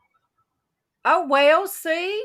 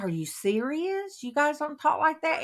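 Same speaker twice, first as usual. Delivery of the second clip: mock disbelief; 7 semitones higher than usual. Retold disbelief at the visitor.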